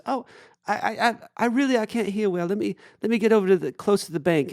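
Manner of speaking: high put-on calling voice; imitated distant reply. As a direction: call back apologetically from a boat. Boatman calling back.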